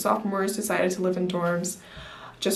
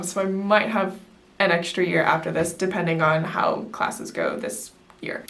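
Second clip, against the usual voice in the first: ordinary talk, nothing marked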